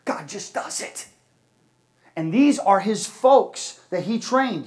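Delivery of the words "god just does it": hushed, breathless whisper of awe; retelling a village leader's whispered answer, hushed whisper. Retold or imitated. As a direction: whisper it like a quiet confession of faith. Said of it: "like in a whisper".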